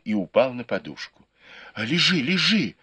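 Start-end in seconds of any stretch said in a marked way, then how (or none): 1.7-2.8 raised-pitch urgent protest; alarmed exclamation. A narrator's reading. Sister exclaims to brother trying to get up, alarmed exclamation. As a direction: exclaim it, urging them to stay down. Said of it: "воскликнула Юй Чуань".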